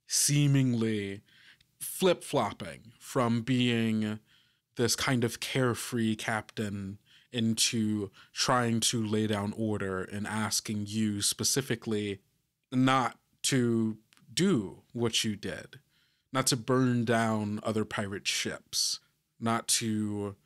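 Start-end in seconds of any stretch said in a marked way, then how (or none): none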